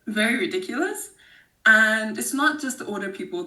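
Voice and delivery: ordinary talk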